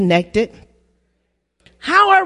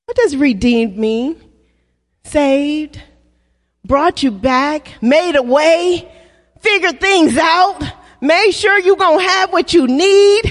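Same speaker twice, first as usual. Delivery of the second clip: ordinary talk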